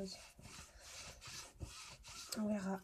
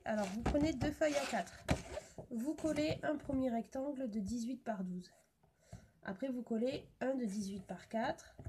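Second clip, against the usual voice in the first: ordinary talk, nothing marked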